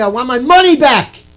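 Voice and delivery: loud, forceful refund demand. Pretend. Attendee demanding money back at lecture, loud. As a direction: shout it across the room. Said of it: "say it nice and loud so everybody can hear it".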